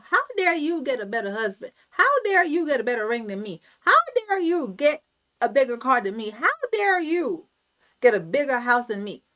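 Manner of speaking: builds from hushed disbelief to a loud, fast indignant rant; jealous outrage. Retold or imitated. Jealous friend ranting, retold.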